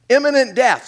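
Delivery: high, stressed echo of the phrase; dramatic repeat. Acted. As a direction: hit imminent death hard and high.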